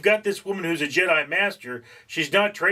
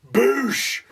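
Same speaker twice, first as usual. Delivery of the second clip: force blast noise; 9 semitones higher, 3 dB louder than usual. Retold or imitated. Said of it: "the Sith Lord's just like".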